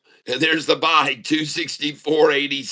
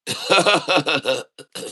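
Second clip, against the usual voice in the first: rueful laugh; 3 semitones higher than usual. Real, not acted. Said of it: "I'm laughing big time".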